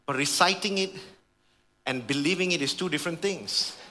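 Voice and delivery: ordinary delivery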